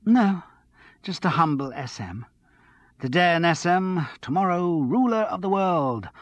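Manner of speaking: a hint of a breathless, excited girl; excited tipsy young woman.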